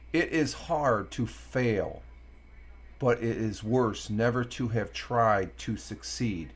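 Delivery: slow, weighty reading of the quote; slow deliberate quote. Shown as a demonstration. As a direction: read it out slowly, word by word. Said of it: "And I'm emphasizing it".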